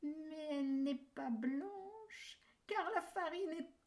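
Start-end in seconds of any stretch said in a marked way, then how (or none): none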